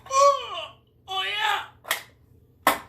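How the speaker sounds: a high squeal of delight; high-pitched excited oh; sudden high yelp. Real, not acted. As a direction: yelp way up high, then settle. Exclamation while drinking.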